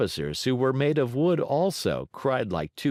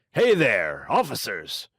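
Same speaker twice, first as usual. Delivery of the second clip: calling out; 4 dB louder than usual. Acted. Calls the guards.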